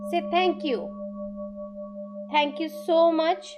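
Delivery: measured meditation voice with long pauses; guided meditation. Acted. Meditation guide asking listeners to thank each breath, guided meditation. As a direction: guide them gently into noticing the breath, unhurried.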